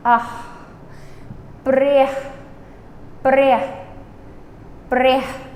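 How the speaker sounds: breh with a rolled r, ending in an open ah. Shown as a demonstration. Teacher models breh with a rolled r, careful pronunciation. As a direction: roll the r in breh. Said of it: "R is always rolled".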